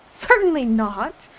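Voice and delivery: loud indignant denial, drawn out. A narrator's reading. Alice refusing the idea of washing, loud and indignant. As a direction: bristle at the very idea. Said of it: "said Alice indignantly".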